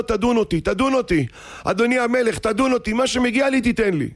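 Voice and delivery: high, insistent pleading; crying out. Retold or imitated. Defendant falls at the king's feet begging judgment, crying plea. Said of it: "צועק".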